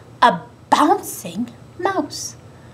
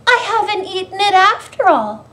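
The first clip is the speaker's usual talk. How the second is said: high-pitched joyful relief; thrilled and relieved; overjoyed cry; 9 semitones higher, 4 dB louder than usual. A narrator's reading. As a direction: exclaim it as a delighted little mouse. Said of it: "she cried".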